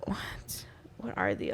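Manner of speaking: very soft, low, bewildered murmur; retold confusion. Bartender recalling staring at the shots, hushed and confused. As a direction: drop to a small, puzzled low voice. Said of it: "like, so confused".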